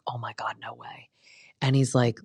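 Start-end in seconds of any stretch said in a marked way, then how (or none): 0.1-1.1 hushed, softer gasp of disbelief; whispered shock. Retold or imitated. Jesse hearing of the proposal, hushed whisper. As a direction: whisper it like a secret. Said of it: "Jesse's like".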